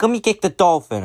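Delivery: put-on high-pitched child's demand; mock bratty kid voice; bratty little kid. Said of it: "And the kid's like".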